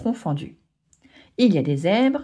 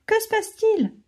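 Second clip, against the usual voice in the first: high, alarmed squeak; sudden mock panic, pitched up; panicked; 13 semitones higher than usual. Fouli startled by a torrent, a high panicked question. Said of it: "s'affole Fouli".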